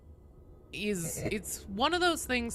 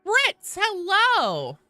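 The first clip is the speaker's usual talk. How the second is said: much higher, louder greeting; sing-song greeting; 12 semitones higher, 9 dB louder, much slower than usual. Real, not acted. Streamer greeting Ritz in a high sing-song. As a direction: call the name out high and bright.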